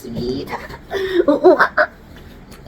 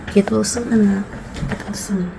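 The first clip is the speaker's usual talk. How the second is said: normal talking voice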